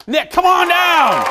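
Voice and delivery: high, loud, drawn-out shout; big slow announcer holler; game-show summons. Real, not acted. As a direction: call a contestant down from the crowd. Host summons contestant like game show.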